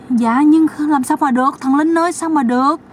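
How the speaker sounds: mock shocked pleading; high protesting woman. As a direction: cry out that it can't be done. Sister-in-law protests the demand.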